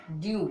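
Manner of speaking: "ड्यू" as one gliding syllable; correct pronunciation. Shown as a demonstration. Teacher repeats the word for learners, correct pronunciation "ड्यू". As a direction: say "ड्यू" with no sound for final e. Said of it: "तो ई को इसको नहीं पढ़ेंगे".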